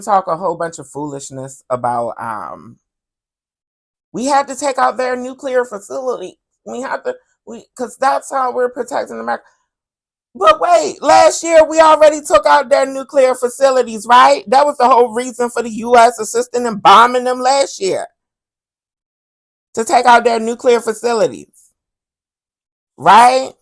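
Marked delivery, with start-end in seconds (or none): 4.1-9.4 high, mock-pompous officials' voice; mocking official excuse. Mocking politicians justifying the strike, in a high mock voice. 10.3-23.5 high, loud incredulous rant. Real, not acted. Vlogger calling out the bombing excuse, loud and incredulous. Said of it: "ranting, raised voice".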